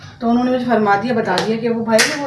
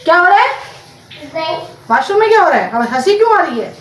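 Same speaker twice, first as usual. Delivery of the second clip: raised, high questioning call; 6 semitones higher, 5 dB louder than usual. Real, not acted. Checking on someone laughing in the bathroom, calling out loudly. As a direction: shout questions through to another room.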